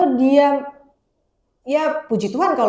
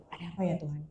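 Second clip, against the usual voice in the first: soft, slightly lowered praying voice; private prayer; 2 semitones lower, 9 dB quieter than usual. Acted. Praying alone in the bathroom when anxious, soft private prayer. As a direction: murmur an understated plea to God. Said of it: "saya masuk kamar mandi".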